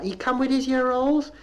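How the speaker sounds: high rising Bristol mother's voice. Retold or imitated. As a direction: lift every line up like a question. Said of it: "And she said to the clinic clerk".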